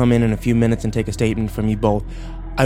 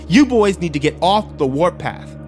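raised-pitch, emphatic warning; stern order; 6 semitones higher than usual. A narrator's reading. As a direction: order the boys to back down. Sheriff orders boys to back off.

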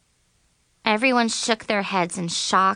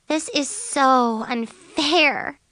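slow, higher-pitched groan of protest; groaned complaint; 6 semitones higher, much slower than usual. A narrator's reading. Friend complaining about the disqualification, a drawn-out groan. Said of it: "Chloe groaned".

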